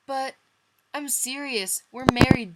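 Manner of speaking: high, loud, fierce insistence; fierce, loud protest from a hurt friend; fierce reply. Acted. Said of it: "Joey replied fiercely".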